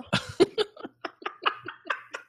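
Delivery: real, high wheezy laugh; high breathless laugh. Real, not acted. Co-host cracking up after the accent bit, high laugh. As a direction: crack up helplessly.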